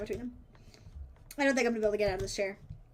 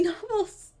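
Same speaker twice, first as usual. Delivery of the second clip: usual speaking voice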